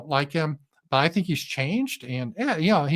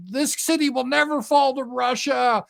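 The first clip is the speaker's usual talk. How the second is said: high, cocky parody of an official; mock confident claim; 10 semitones higher than usual. Parodying Ukraine's boast, high mocking voice.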